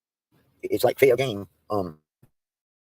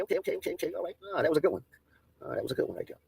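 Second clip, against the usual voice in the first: usual speaking voice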